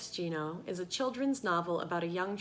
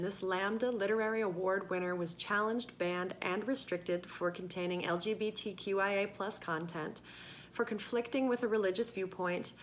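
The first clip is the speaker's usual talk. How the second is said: ordinary delivery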